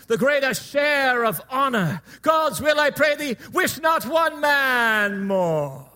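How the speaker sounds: rousing Shakespearean battle speech, building to long ringing phrases; rally the troops like a stage king; Henry the Fifth. Acted. King inspiring his outnumbered troops at Agincourt, declaimed oration. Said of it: "he needs to inspire his troops to fight".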